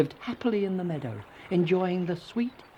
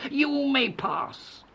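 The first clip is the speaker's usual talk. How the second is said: high, slow troll; drawn-out troll consent; 11 semitones higher, much slower than usual. A narrator's reading. Troll lets the second goat go, slow troll voice. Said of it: "said the troll".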